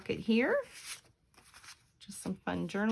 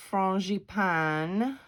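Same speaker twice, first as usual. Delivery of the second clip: slow, careful French pronunciation of frangipane; French attempt; much slower than usual. Shown as a demonstration. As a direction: try the French sound of frangipane. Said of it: "my French accent is horrible".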